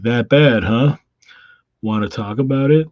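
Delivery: a sidekick asking with concern; Robin. Acted.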